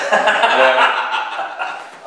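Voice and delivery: loud burst of laughter; real laugh. Real, not acted. Manager joking about his own accent, loud laugh. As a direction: laugh loudly at your own joke.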